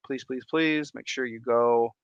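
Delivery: usual speaking voice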